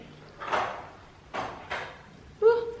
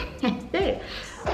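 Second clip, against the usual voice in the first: laugh; 7 semitones higher, 4 dB quieter than usual. Real, not acted. Laughs at own girly place.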